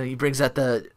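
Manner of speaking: ordinary talk, nothing marked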